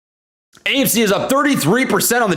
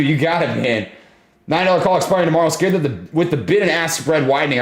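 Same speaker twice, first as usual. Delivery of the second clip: usual speaking voice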